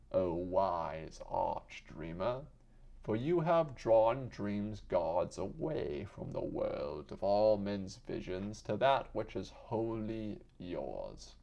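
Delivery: usual speaking voice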